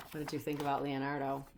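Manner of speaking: ordinary delivery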